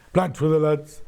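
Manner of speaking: imitated Wolverhampton accent on a catchphrase. Presenter repeating Charlie's plaid catchphrase, mock Wolverhampton accent. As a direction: mimic a colleague's Wolverhampton accent. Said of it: "in his Wolverhampton accent".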